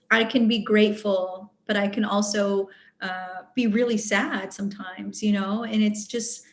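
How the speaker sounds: ordinary delivery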